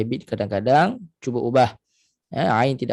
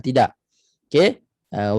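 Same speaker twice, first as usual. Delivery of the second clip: ordinary talk, nothing marked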